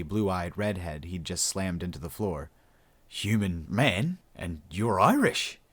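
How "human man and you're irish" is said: slow, questioning Australian voice; drawn-out bemused questions; puzzled Australian. A narrator's reading. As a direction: echo it slowly, baffled, rising at the end. Australian echoing her in confusion.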